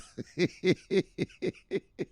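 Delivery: long run of high, wheezy laughs; high giggling fit. Real, not acted. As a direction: lose it completely mid-thought. Cracks up mid-sentence.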